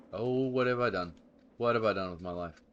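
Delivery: loud groaning what have I done; dismayed outcry. Real, not acted. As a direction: cry out in dismay. Falls off a ledge in game.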